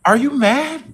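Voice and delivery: high, sing-song fake sympathy; mock concerned coworkers. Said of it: "they be trying to comfort, like".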